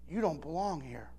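hushed, gentle low line; bartender's soft rebuke. Retold or imitated. Bartender quietly tells the young preacher he doesn't belong, hushed. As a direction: say it softly, like a kind warning. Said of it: "and then she said this".

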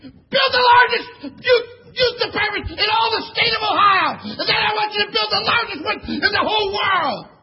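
loud, high, crying exhortation; rally the church to dare; tearful shouting call to action; full-force preaching. Acted. Preaching challenge to the congregation.

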